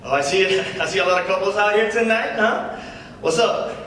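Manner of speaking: lightly voiced comedy-club patter; stand-up comic. [name] opening his set to the crowd, casual comic patter. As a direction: work the crowd with an easy opener.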